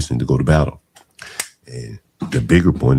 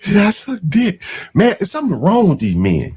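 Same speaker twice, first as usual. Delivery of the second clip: mock outrage. Mocking the callers' talk.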